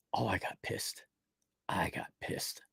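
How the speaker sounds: whispered confession; soft, whispered aside. Real, not acted. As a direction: breathe it out unvoiced. Whispered aside about anger.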